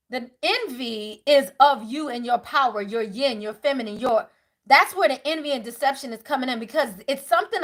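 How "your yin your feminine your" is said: ordinary talk, nothing marked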